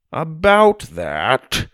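drawn-out grandpa voice; slow, hesitant old man. Acted.

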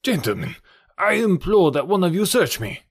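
high-pitched, pleading character voice; imploring Count. Acted. Accused Count appeals to gentlemen, imploring.

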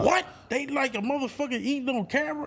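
high, fast, disbelieving squeak; can't believe it, voice jumps up; incredulous. Retold or imitated. Reacting to going viral.

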